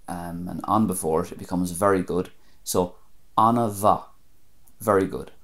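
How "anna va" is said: "anna va" with a V sound; Munster pronunciation. Shown as a demonstration. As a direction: say "anna va", small "a" between "an" and "va". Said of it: "M H is a V sound".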